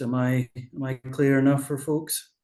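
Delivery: ordinary delivery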